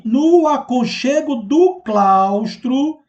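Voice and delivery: "No aconchego do claustro" bem esticado e mais agudo; leitura com encadeamento. Shown as a demonstration. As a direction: alongar cada palavra de "No aconchego do claustro", sustentando o tom sem fechar a frase. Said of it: "houve o encadeamento do segundo para o terceiro verso". ("No aconchego do claustro" arrastado, em suspenso)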